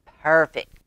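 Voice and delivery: ordinary delivery, nothing marked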